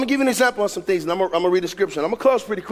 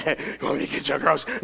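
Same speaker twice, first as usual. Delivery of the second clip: low, soft mock creep voice; creepy dude; 9 semitones lower, 7 dB quieter than usual. A creep offering to buy a woman's groceries, mock creepy. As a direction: put on a creepy guy's low murmur. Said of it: "some creepy dude".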